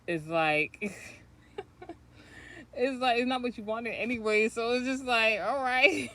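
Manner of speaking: bubbling over with laughter; laughing. Real, not acted. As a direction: laugh the words out. Laughing through a spiritual point.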